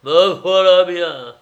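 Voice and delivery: boozy exclamation before a laugh; tipsy self-mockery. A narrator's reading. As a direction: drawl it out, pleased and plastered. Drunkard laughing at his own state.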